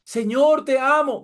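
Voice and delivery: high mock cry of devotion; mock tearful devotion. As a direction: imitate an emotional worshipper calling out. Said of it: "eso los pone a llorar".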